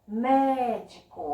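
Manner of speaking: "Médico" drawn out, MÉ most prominent; stressed first syllable. Shown as a demonstration. Teacher repeating for pupils, slow "Médico" with stressed MÉ. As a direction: hit and hold MÉ in "Médico". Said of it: "mais ouvido, é o mé".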